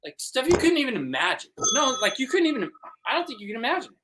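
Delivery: high, breathless hype voice; excited. Acted. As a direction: gush about it, wide-eyed. Excited boast, high far voice.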